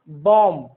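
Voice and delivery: "बॉम्ब" as bom, ending on m; low, clear "बॉम्ब" with no final b; silent B. Shown as a demonstration. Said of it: "गलत उच्चारण है".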